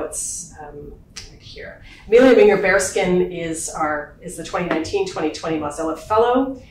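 ordinary delivery

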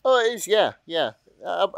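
ordinary talk, nothing marked

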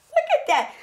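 high, laughing look at that; laughing delight. Real, not acted. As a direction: show off the result through a laugh. Showing off her circle.

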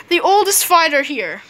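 usual speaking voice

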